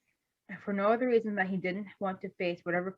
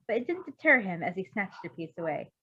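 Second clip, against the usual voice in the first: ordinary delivery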